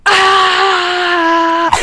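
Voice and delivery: high loud mock child yell; imitating a screaming little brother; child's scream imitated. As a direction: shriek like a little kid. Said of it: "all I hear in the background is just".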